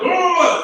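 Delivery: very high, loud whooping "oh"; mock excited cry. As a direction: squeal out as if spotting one of your own. Imitates startled reaction of crazy people.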